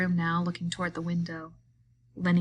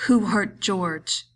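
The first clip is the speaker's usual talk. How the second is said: slow, louder, threatening; menacing demand; 6 dB louder, much slower than usual. A narrator's reading. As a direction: advance on him and demand an answer. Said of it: "he demanded".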